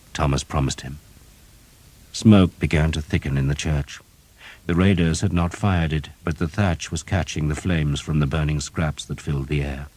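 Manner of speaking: ordinary delivery, nothing marked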